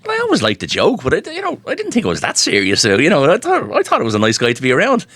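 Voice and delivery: high, lightly voiced modest reply; mock bashful reply. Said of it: "And I was like".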